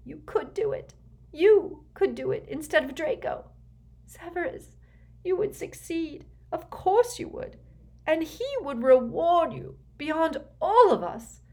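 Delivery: high, tearful, desperate plea; gasped. A narrator's reading. Tearful mother begging Snape to replace Draco, gasping. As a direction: beg him urgently, voice way up. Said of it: "she gasped".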